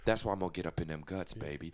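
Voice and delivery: quick, low come-on; deep, hushed sweet talk; smooth seducer. Acted. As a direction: drop low and soft on the pet name. Continues seducer voice demo.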